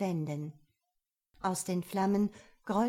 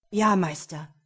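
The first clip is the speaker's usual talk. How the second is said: louder submissive servant; dark mage voice; 8 dB louder than usual. A narrator's reading. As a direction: answer the master dutifully. Mage answers.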